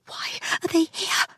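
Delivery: barely voiced, breathy question; breathed whisper. A narrator's reading. Narrator asks Tess behind the door, whispering. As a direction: breathe the question, afraid to be heard. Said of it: "I breathed".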